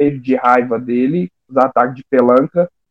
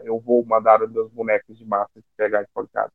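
softer, cartoonish evil villain threat; mustache twirling villain; 5 semitones lower, 8 dB quieter than usual. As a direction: ham up a cartoon baddie, quietly. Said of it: "vilão do dia que ele vai dar risada maligna e falar".

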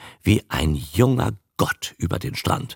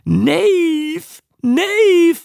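very high, yelping creature cry; animal bark; 5 dB louder than usual. A narrator's reading. Second beast further along the beach, high quacking bark. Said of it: "bellte es auch ein Stück weiter".

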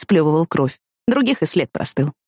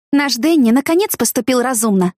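higher-pitched, mildly proud announcement; a touch of pride; 6 semitones higher than usual. A narrator's reading. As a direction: present him proudly. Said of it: "с гордостью объяснила".

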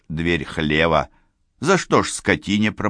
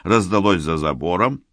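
ordinary delivery, nothing marked

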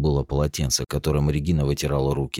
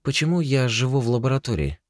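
put-on young woman voice; 10 semitones higher than usual. Regina's questioning voice.

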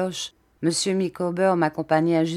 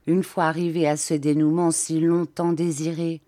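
ordinary delivery, nothing marked

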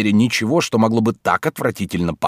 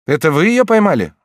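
blurted question; 9 semitones higher, 6 dB louder than usual. Acted.

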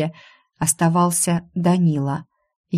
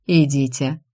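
restrained, firm order; understated command; 3 semitones lower than usual. A narrator's reading. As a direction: order them off firmly. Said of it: "велел он".

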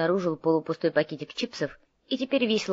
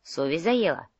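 ordinary delivery

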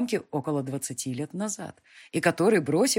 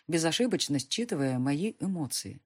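plain reading voice

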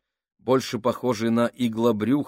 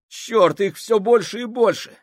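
much higher, louder panicked voice; panicked cry; 12 semitones higher, 7 dB louder than usual. Acted. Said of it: "простонал Фабрини". (панический крик)

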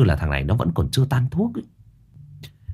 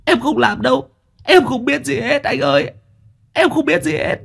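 much higher, tearful begging; whimpering plea; 14 semitones higher than usual. Acted. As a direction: beg like a sobbing boy. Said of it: "Thằng Duy mếu máo".

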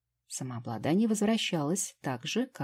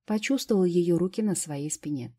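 plain reading voice

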